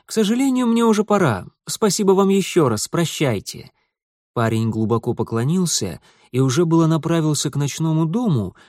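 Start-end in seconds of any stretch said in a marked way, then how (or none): 0.1-3.7 put-on high, polite teenage boy; higher, eager voice of a polite boy; young boy voice. Boy politely takes leave.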